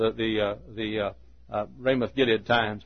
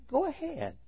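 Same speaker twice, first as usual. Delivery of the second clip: mocking; 6 dB quieter than usual. Micaiah mocks the four hundred prophets, softly mocking. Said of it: "He mocked those prophets".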